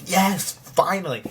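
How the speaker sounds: high, drawn-out mock cheer; retold elated relief. Host imitating a viewer as a character gets pruned, elated. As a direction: draw it out in exaggerated relief. Acting out a watcher's reaction.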